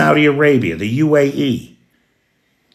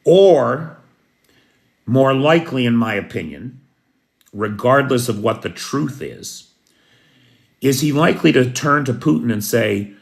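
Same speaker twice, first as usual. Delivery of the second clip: ordinary talk, nothing marked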